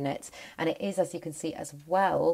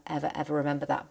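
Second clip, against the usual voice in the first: normal talking voice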